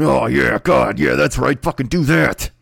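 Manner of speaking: hammed-up, forceful climax talk; growing mock aggression, as if egging yourself on; aggressive mock ecstasy. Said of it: "that would be aggressive and strange".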